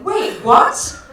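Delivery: high, loud shocked "Wait, what?"; indignant surprise. Retold or imitated. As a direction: blurt it out in shocked disbelief. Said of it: "Like, I'm indignant".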